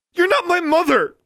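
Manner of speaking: loud, very high-pitched scream; furious shout. A narrator's reading. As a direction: scream it as a hurt rejection. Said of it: "Alexandra shouted".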